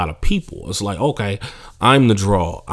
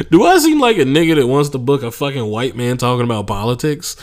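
normal talking voice

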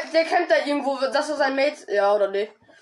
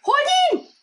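calling out; 13 semitones higher, 3 dB louder than usual. Real, not acted. Calling teammates to chase.

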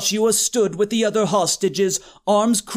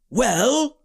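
impatient, higher-pitched questioning prod; 4 semitones higher than usual. A narrator's reading. Joshua waits on his friend reading the manual, impatient. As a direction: press them for an answer. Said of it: "Joshua said impatiently".

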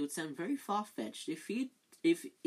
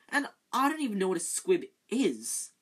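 protesting; 5 semitones higher, 7 dB louder than usual. Acted. Harry protesting.

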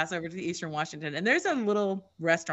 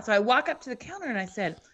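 ordinary talk, nothing marked

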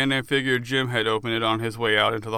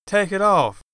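higher, louder command; firm demand; 7 semitones higher, 4 dB louder than usual. Acted. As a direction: order him to show you. Des demands bandage off.

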